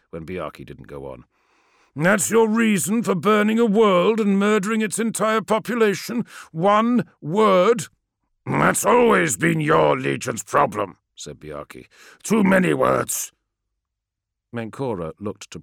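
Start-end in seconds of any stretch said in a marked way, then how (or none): 2.0-7.9 loud, higher incredulous outrage; outraged. Acted. Menkora outraged at Biaki. 8.5-13.3 louder, higher contemptuous warrior; gruff retort. Acted. Biaki retorts.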